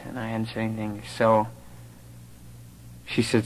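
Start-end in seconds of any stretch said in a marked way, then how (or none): 0.0-1.0 quiet, stunned admission; much softer, hushed. Retold or imitated. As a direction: drop the voice right down. Repeats how silent he was.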